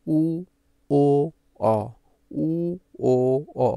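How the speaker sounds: loud, separate vowels with pauses between; loud vowel demonstration. Shown as a demonstration. Teacher showing students how to pronounce vowels out loud, loud demonstration. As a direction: sound out each vowel loudly. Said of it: "জোরে জোরে উচ্চারণ করো".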